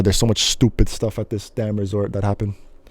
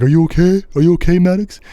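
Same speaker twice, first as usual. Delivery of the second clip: worried dad; 7 semitones higher, 4 dB louder than usual. Retold or imitated. Dad checking on crying son.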